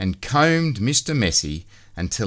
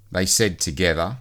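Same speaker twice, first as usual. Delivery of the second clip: ordinary delivery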